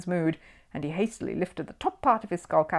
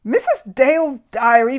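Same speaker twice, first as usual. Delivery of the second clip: loud, slow roaring question; bellowed; 7 semitones higher, 9 dB louder than usual. A narrator's reading. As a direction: roar it in disbelief. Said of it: "bellowed Sir Archibald".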